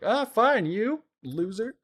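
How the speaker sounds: wife taunting the prince; mocking taunt. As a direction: toss off a dismissive jab.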